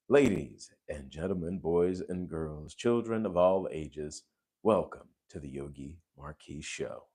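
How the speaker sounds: unhurried, ceremonious host introduction; show host announcer. Pretend. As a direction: welcome the audience like a measured master of ceremonies.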